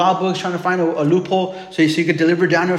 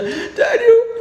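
high, tearful mock wail; croaky lamenting voice. Preacher imitates the king at the den, croaky tearful wail. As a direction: cry out in an exaggerated croaky lament. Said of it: "a croaky voice".

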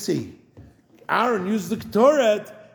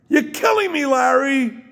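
radio host scream; 9 semitones higher than usual. Retold or imitated. Rabbi imitating the radio host's famous screaming catchphrase. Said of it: "Still screaming".

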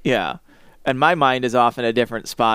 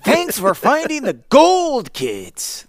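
high, slow sneering villain; 11 semitones higher, slower than usual. Acted. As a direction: gloat over the kids slowly. Voicing gang leader villain.

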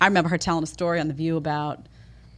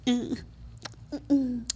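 snooty elitist; 7 semitones higher, 3 dB quieter than usual. Retold or imitated. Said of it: "that they can afford to just".

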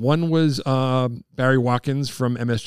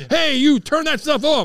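high, louder mock yell; 14 semitones higher, 4 dB louder than usual. Chappelle Show driver yelling at kids, mock yell. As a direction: bark it out of a car window.

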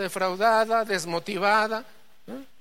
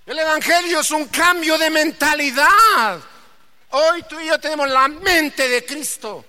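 high, loud preaching shout; 8 semitones higher, 7 dB louder than usual. Real, not acted. Preacher shouting at the congregation about changing minds. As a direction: hammer it home to the church.

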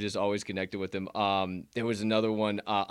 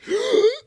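sharp gasp; 10 dB louder than usual. Retold or imitated. Imitating vacuum effect.

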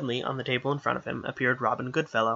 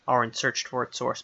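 ordinary delivery, nothing marked

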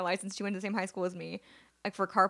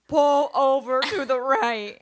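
slow, louder mock megaphone order, breaking into a laugh; loudspeaker yell; 5 semitones higher, 9 dB louder, much slower than usual. Imitating the motorcycle cop ordering her over, slow loudspeaker yell. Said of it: "get on the loudspeaker and yell at me".

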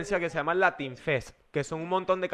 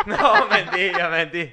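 laughing through a quick denial; 3 dB louder than usual. Real, not acted. Laughing as he takes back the teasing of his friend. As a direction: take it back between laughs.

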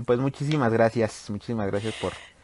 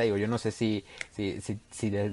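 normal talking voice

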